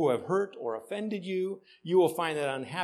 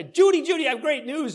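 higher and louder, calling out gleefully; mock excited greeting; 11 semitones higher, 9 dB louder than usual. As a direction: pastor acting himself calling to Judy with news, excited. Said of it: "I say".

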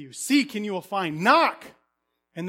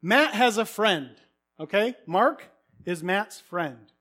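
usual speaking voice

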